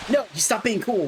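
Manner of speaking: high, rising cry of dismay; dismayed outcry. Real, not acted. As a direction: protest in shocked disbelief. Dog turns hostile.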